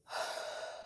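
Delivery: a long unvoiced sigh; heavy sigh. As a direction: sigh out in weary patience. Audible sigh.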